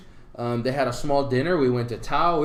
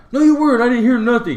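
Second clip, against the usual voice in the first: high, loud mock protest; indignant denial; 9 semitones higher, 9 dB louder than usual. Drunk friend contradicting the guys at the door, indignant. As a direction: imitate a stubborn, loud denial.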